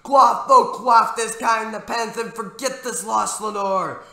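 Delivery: high, loud, half-sung pleading; rising, ringing lament at full voice; impassioned crying out. A narrator's reading. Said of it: "I cried".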